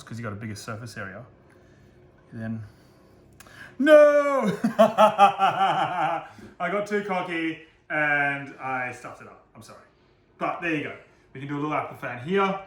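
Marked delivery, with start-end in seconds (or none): 3.8-4.5 sudden high yelp of dismay; real startled shout; shocked no. Real, not acted. Cooking trick goes wrong. 4.7-8.5 slow words stretched through laughter; real laughing, dragged out. Real, not acted. Laughing at own failed trick.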